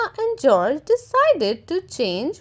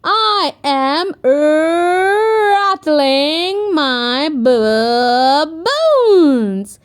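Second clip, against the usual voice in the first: put-on slow, louder playful child; skeleton Peppa; 2 semitones higher, 6 dB louder, slower than usual. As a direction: rattle it out slowly.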